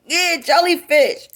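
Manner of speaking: high, loud happy "jellyfish"; retold excitement. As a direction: pounce on the word you finally remember.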